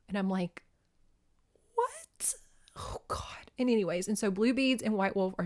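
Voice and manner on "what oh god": high, breath-only disbelief; stunned whisper. Acted. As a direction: whisper it, emotionally floored. Overwhelmed reaction to sad story.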